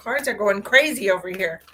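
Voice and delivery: ordinary talk, nothing marked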